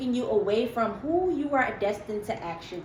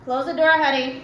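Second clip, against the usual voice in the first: louder call to someone in another room; 3 semitones higher, 7 dB louder than usual. Real, not acted. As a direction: raise the voice to reach someone off-camera. Calling out to someone off-camera.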